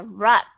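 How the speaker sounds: RUP with a clear short U; stressed "rup", full vowel; clearly pronounced. Shown as a demonstration. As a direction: say "rup" strongly and distinctly. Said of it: "Chúng ta đọc rõ ra".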